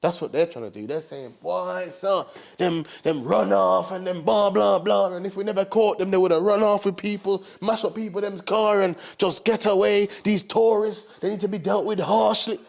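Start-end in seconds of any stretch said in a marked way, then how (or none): 1.4-12.6 lightly voiced Jamaican patois, indignant; a hint of a Jamaican cop demanding harsh punishment; Jamaican officer.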